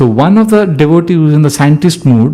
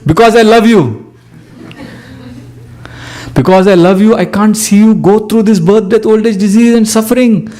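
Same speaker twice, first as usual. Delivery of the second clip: fond scolding, pitched higher and higher; loving urgent admonition; 6 semitones higher than usual. Retold or imitated. Guru answering the debating devotee, tender but urgent. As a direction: scold out of love, building urgency. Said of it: "Prabhupada immediately says".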